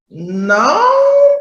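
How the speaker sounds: put-on high child's "No" of protest; a child pushing back, voice jumping up; mock kid protest. Kid answering parent, high protesting no.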